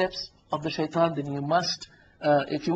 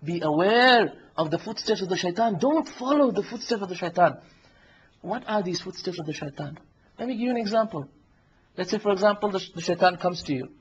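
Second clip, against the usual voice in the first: ordinary delivery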